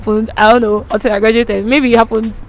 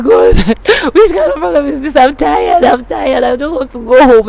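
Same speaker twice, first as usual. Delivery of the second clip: retold crying; 2 semitones higher than usual. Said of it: "I was just crying".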